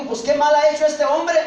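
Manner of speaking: high, loud, incredulous question; baffled ruler pleading innocence; stunned disbelief. Acted. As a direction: protest in astonishment at the crowd. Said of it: "Pilato se queda pasmado".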